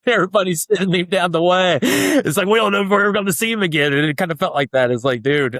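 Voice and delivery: high, loud, laughing through the words; can barely get it out for laughing; laughing while talking. Real, not acted. Telling the host about the send-off, laughing hard.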